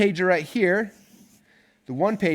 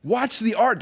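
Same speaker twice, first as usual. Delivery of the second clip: exasperated retort; 6 semitones higher than usual. Retold or imitated. Coach answering complainers, exasperated and raised. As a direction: fire it back, fed up. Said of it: "I'm like".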